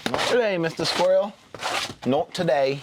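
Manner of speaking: high-pitched teasing refusal; playful mock warning. Camper to a squirrel after his food, playful mock warning.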